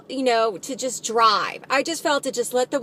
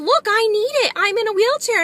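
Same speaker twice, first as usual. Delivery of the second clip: high-pitched, insistent protest; retold indignation; 10 semitones higher than usual. Wheelchair user confronting teenagers over the spot, indignant. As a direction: plead the case, pitched well up. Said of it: "I was really angry".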